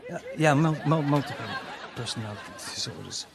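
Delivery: normal talking voice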